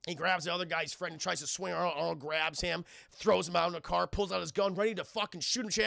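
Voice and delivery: normal talking voice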